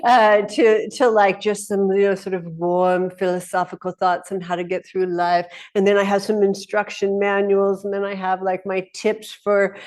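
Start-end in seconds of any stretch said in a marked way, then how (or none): none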